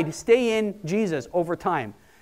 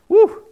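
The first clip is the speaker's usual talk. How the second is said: much higher, louder laughing woo; excited woo; 9 dB louder than usual. Real, not acted. Preacher describing anointing, laughing excited woo. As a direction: let out a thrilled laughing whoop.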